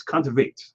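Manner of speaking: Kontaveit said as KON-tuh-vate; giving the pronunciation. Shown as a demonstration. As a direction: show Kontaveit with a final VATE. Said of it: "I think you're right".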